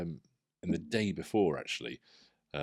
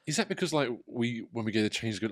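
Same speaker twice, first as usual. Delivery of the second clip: ordinary talk, nothing marked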